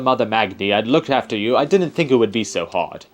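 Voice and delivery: put-on loud, scolding old guardian; hammed-up old man with a put-on accent. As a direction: lecture a young ward as a fussy old man. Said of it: "You gotta have an old man character in this game".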